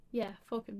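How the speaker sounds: ordinary delivery, nothing marked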